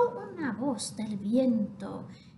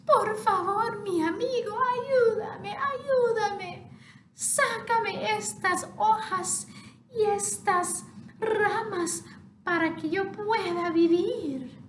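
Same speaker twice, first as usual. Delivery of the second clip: pleading water voice; 7 semitones higher than usual. Acted. Choked stream pleads with the half chick, high and pleading. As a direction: beg the chick to clear your waters.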